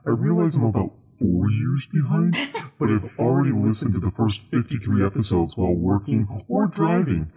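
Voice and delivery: normal talking voice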